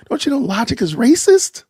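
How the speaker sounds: high-pitched sarcastic objection; mock scolding. Mocking someone for using logic, high mock scolding.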